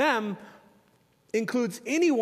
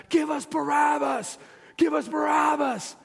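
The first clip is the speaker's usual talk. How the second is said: slow, drawn-out mock crowd shout; 5 semitones higher, slower than usual. Preacher voicing the mob demanding Barabbas, hammed-up shout. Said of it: "they start screaming out".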